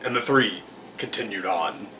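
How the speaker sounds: usual speaking voice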